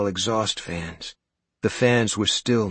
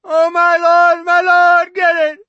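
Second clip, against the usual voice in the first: slow, high, loud pleading shriek; terrified scream; 12 dB louder than usual. Acted. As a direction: wail it out in sheer terror. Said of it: "she screamed".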